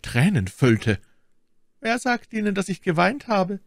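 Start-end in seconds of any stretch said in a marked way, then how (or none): 1.8-3.6 high, prompt retort; quick question. A narrator's reading. Milady denies her tears, asking quickly. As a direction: jump in promptly, pitched high. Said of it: "fragte Milady rasch".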